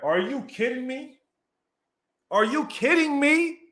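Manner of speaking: high-pitched, incredulous delight; amazed disbelief. Real, not acted. Streamer reacts to Jacobs's spin move, in amazed disbelief.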